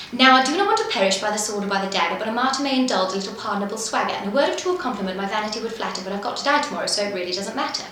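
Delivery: fast, crisp patter with every consonant sharp; rapid patter demo. Shown as a demonstration. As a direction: rattle it off fast but keep each word clear. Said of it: "So it goes something like this".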